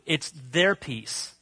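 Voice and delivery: "their" drawn out and stressed very slowly; slow emphasis on THEIR. Shown as a demonstration. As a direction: lean hard on "their" in "It's their peace", slowly. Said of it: "It's not your peace".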